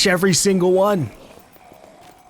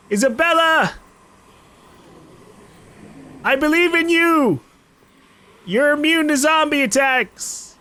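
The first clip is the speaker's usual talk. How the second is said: higher, bright calls of encouragement; rooting for your partner like a fan; encouraging cheer; 8 semitones higher than usual. Real, not acted. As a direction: cheer her on from across the horde. Cheering on his game partner.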